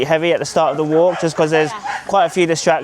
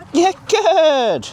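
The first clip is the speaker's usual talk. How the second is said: praising the dog; 12 semitones higher than usual. Real, not acted.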